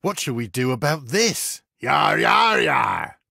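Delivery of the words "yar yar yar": loud pirate growl, falling each time. Acted. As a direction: bellow it like a rowdy pirate. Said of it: "the pirate says".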